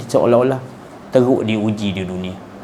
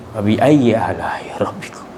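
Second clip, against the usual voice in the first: slow, hushed whisper of scripture; whispered verse; 3 semitones lower than usual. Acted. Preacher quotes the Quran to the congregation in a slow whisper. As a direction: breathe the verse slowly, barely voiced.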